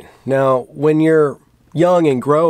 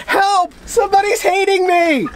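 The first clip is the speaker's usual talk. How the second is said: much higher, whiny fake wail for help; mock panicked cry. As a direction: cry out like a hammed-up victim. Mock victim crying out.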